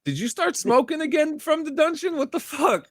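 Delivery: high, squeaky teasing voice put on; squeaky mock voice. Teasing the host in a high squeaky voice. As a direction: pitch way up to mock a friend.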